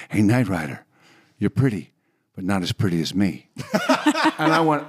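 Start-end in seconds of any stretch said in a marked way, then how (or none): 0.1-3.5 low-pitched teasing boast; Muhammad Ali. Retold or imitated. Boxer greeting a TV star, low-voiced impression. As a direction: drop low for a champ's playful brag. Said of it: "including Muhammad Ali".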